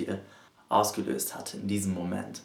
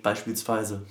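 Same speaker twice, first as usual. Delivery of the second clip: ordinary talk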